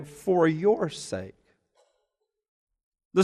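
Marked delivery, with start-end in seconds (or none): 0.0-1.3 "for your sake." said slowly and softly, leaning on your; slow hushed phrase; slow, soft emphasis. Shown as a demonstration. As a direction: draw out "for your sake" quietly. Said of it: "notice the phraseology there".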